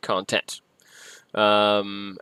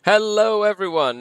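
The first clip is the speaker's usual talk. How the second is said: ordinary talk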